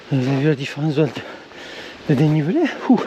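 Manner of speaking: panting, out of breath from the climb; breathless, gasping between words. Real, not acted. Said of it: "Désolé, je suis essoufflé".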